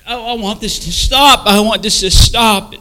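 loud, high, building plea; desperate outcry. Retold or imitated. Tormented man begging for it to end, crying out loudly.

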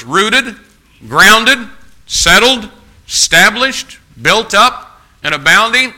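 high, loud, one word at a time; emphatic roll call. Real, not acted. Preacher naming traits of faithful church members, emphatic roll call. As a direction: ring out each trait as its own proclamation.

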